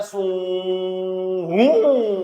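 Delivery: drawn-out whoosh of a passing truck. Shown as a demonstration. Teacher imitating a truck passing to explain Doppler, slow whoosh. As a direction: stretch it like a truck roaring past very slowly. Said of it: "ऐसे करके निकल जाता है".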